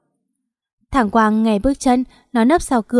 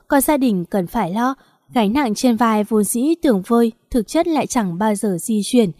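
ordinary delivery

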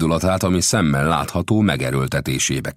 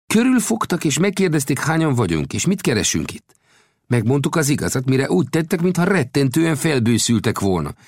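higher-pitched voice for the gambusino, earnest storyteller; gambusino's voice; 12 semitones higher, 3 dB louder than usual. A narrator's reading. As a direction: tell the tale as the friendly gold digger. Gambusino recounts.

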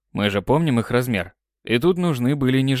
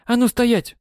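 sharp command; 11 semitones higher than usual. Acted. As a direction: bark out the order. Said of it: "неожиданно приказала".

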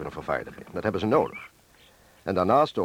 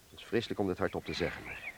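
much softer, weighed down and reluctant; heavy quiet admission; 3 semitones lower, 13 dB quieter than usual. Acted. Husband admitting to Santje they must work for Germans, softly and heavily. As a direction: let it almost die in your mouth. Confessing the collaboration decision.

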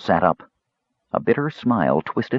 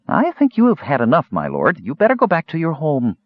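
higher-pitched barmaid standing up to a customer; raised-pitch character voice for a barmaid; voiced barmaid; 8 semitones higher than usual. A narrator's reading. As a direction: tell a drunken lord he's had enough, pitched up. Barmaid confronts drunk lord in story.

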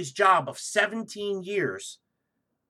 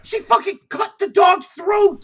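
outraged; 12 semitones higher, 9 dB louder than usual. Real, not acted. Outraged retelling.

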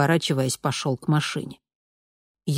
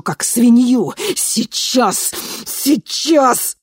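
raging yelling veteran; furious shout; 5 semitones higher, 5 dB louder than usual. A narrator's reading. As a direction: scream it, out of control. Enraged veteran attacks the man.

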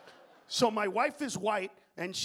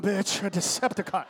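usual speaking voice

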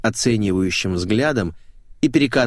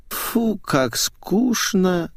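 bored whine; 9 semitones higher, much slower than usual. A narrator's reading. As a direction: drag out each word, bored starlet. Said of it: "заныла мамзель".

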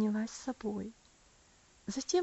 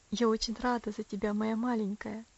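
ordinary delivery

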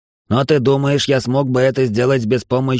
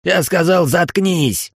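a sharp, much higher angry yell; angry shout; 6 semitones higher, slower than usual. Acted. Эл yelling at Ториан to shut up, furious. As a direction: cut him off hard.

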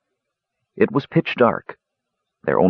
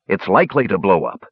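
usual speaking voice

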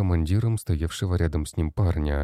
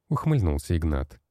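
ordinary delivery, nothing marked

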